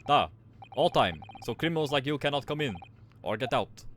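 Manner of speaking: put-on proud guard in a Russian accent. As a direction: boast about first class in a Russian accent.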